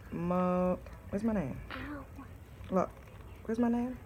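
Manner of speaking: ordinary talk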